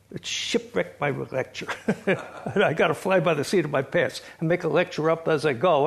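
laughing while telling the story; amused laughing. Real, not acted. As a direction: chuckle through your own mishap. Laughing at his own lecture disaster.

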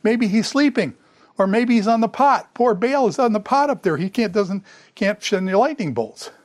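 high-pitched, taunting mock concern; sarcastic mockery. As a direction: needle them with fake pity, pitch up. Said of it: "he's mocking these people. It's sarcasm".

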